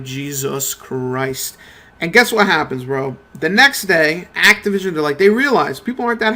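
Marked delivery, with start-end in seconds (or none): 0.0-1.6 low, slow, drawn-out sigh of disbelief; exasperated groan. Retold or imitated. A viewer groaning at Activision's news, slow and low. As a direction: let it sag out, done with it all.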